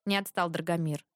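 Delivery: ordinary delivery, nothing marked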